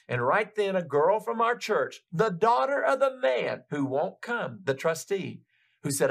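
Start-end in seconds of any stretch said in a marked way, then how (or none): none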